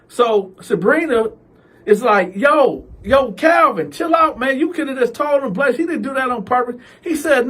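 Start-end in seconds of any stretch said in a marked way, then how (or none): 2.4-6.7 higher pleading mother; calm him down high. Retold or imitated. Sabrina calming Calvin.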